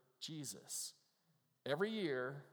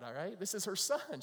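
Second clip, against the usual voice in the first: ordinary talk, nothing marked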